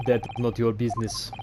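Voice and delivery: put-on curt Russian accent; cold Russian accent. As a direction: shut them down like a Russian guard. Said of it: "I like to do Russian accents".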